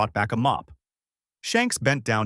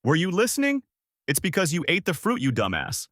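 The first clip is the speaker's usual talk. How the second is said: higher, scolding shout; exasperated yell; 7 semitones higher than usual. Acted. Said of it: "Shanks then yelled out".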